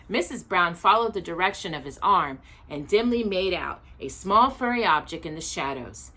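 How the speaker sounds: ordinary delivery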